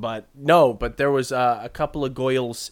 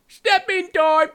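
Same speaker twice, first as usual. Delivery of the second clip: mock Cockney; 6 dB louder, slower than usual. Recalling the Mary Poppins song, mock Cockney belted high. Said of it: "There's that accent".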